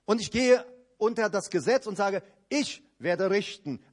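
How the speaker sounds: ordinary talk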